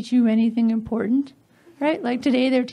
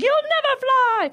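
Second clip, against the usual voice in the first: dismissive Santa; 9 semitones higher, slower than usual. Acted. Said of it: "He's like".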